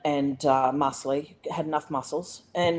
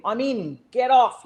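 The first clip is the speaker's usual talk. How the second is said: louder, higher mock yell; raised voice, short of screaming; retold scream; 6 semitones higher, 6 dB louder than usual. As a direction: re-enact yelling someone away. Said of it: "screamed at him".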